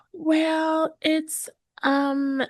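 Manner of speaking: high, drawn-out hesitant stalling; unsure. Acted. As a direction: stall on a high pitch, acting unsure of your price. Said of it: "don't seem like they're a hundred percent sure".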